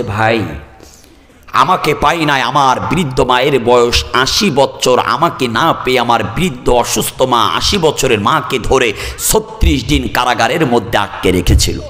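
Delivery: ordinary talk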